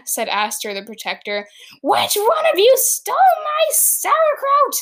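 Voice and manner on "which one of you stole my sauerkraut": high, loud, furious shriek; screamed accusation. Acted. Horatio accusing the princesses of theft, screaming high and loud. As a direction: scream it like a stolen-pickle tantrum. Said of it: "he screamed".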